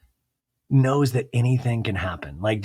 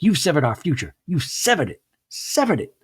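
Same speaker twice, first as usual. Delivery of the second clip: hammed-up wounded verdict; impersonating Mary, building to high severed it; mock dramatic accusation; 5 semitones higher than usual. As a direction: pitch climbing higher each time on severed. Mary acted in a quarrel.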